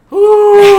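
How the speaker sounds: high, loud imitated whoo; Roman's whoo. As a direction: let out a big wrestler whoo. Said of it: "he likes to do the".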